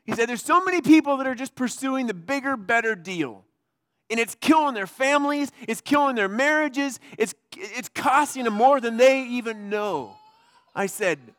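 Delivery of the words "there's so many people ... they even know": louder and higher, pressing on killing; passionate tipsy rant. Retold or imitated. Drunk man lecturing the pastor about the bigger better deal, loud and passionate. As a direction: preach it at your seatmate with conviction.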